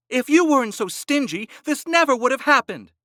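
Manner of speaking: high, loud yell. A narrator's reading. Wife yelling at her stingy husband, high yell. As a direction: yell it right in his face. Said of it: "Gittel yelled".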